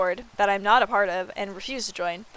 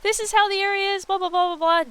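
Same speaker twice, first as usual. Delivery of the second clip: much higher, breezy tour-guide voice; tribemates' breezy tour; 11 semitones higher, 6 dB louder than usual. Retold or imitated. Streamer mimicking her tribemates showing her the area, breezy high voice. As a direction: chirp it off like a careless tour guide. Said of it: "they're like".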